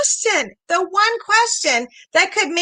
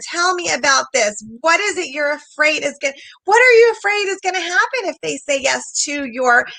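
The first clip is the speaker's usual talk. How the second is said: ordinary delivery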